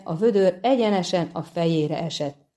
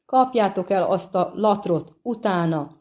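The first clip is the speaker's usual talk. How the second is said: ordinary delivery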